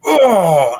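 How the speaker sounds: loud startled oh; sudden shocked cry; dragon startled. Acted. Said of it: "he's like".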